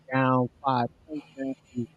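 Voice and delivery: ordinary delivery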